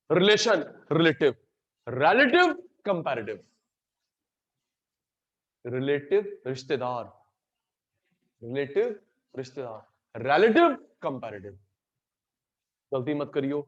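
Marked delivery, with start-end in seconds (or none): 1.9-3.5 pair relative up and comparative down; contrastive drill. Shown as a demonstration. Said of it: "pronunciation से meaning बदल जाता है". 10.2-11.5 pair relative up and comparative down; contrastive drill. Shown as a demonstration. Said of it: "pronunciation से meaning बदल जाता है".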